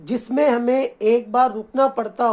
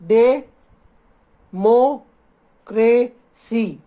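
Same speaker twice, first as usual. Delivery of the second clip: Democracy very slowly, डे, मो, क्रे, सी, long pauses between; syllable by syllable; much slower than usual. Shown as a demonstration. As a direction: teach democracy in four widely separated beats. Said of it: "हमें इसमें चार बार रुकना पड़ता है". (Democracy को बहुत धीरे, चार जगह रुककर बोलें)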